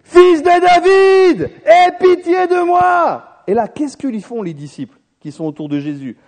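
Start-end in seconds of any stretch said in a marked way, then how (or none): 0.0-3.4 long, drawn-out high shout, pleading; high, slow pleading shout; crying out. Retold or imitated. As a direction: call out slowly across the crowd, begging for mercy. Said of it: "il se met à crier".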